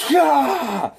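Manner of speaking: high, forceful villain yell; sudden high battle cry; Electro's attack yell. Acted. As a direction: let out a high attacking shout. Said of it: "And then Elektra goes".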